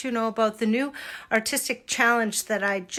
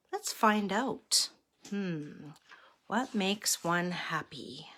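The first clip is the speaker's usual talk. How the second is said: quiet musing; 2 semitones lower, 6 dB quieter, slower than usual. Acted. Old wise monk pondering the youngest monk's question, quiet musing. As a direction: mull it over, trailing down nearly to a whisper.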